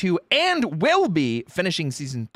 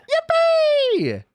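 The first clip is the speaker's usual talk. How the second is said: high, gleeful yippee; squealing cheer; 4 dB louder than usual. Real, not acted. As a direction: cheer like a cartoon.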